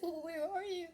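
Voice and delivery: high, crying mother's voice; retold tearful question. Mother on the phone asking her lost son, crying. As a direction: ask it through tears, pitch way up. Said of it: "she was just bawling".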